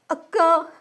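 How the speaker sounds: high plaintive call; small, weepy cry for comfort; tearful. A narrator's reading. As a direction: call out to an elder sister through tears. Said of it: "என்றவளின் கண்ணீர் இன்னுமே அதிகரிக்க".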